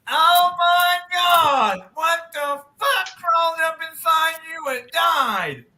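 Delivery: high, yelled disgust through a pinched nose; disgusted shout. Acted. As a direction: yell in horror at the stench. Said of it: "fingers over his nose going".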